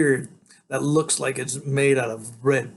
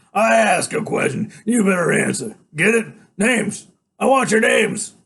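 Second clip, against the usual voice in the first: loud, raised, demanding tough-guy voice; menacing biker boss; 8 semitones higher, 7 dB louder than usual. A narrator's reading. Iggy demands the kids' names, loud menacing order. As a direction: demand an answer forcefully.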